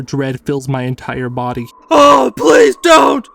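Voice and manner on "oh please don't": high, loud, drawn-out screams; panicked full-volume plea; terrified pleading. A narrator's reading. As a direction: scream and beg in dread. Terrified pleading, pinned by attacker.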